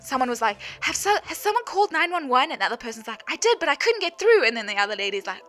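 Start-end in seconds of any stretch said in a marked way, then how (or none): 0.8-2.5 higher, urgent bystander question; lightly acted urgent bystander. 3.3-4.5 higher, louder flustered reply; lightly voiced flustered woman; flustered bystander.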